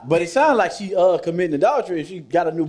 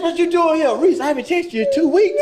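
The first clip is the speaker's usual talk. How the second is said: voicing the ex; 9 semitones higher than usual. Voicing the ex in retold scene.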